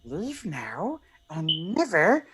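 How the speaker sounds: slow, wavering creature voice; Smeagol. Acted. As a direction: draw it out in swooping tones, not yet shouting. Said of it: "I'm gonna do the impression now".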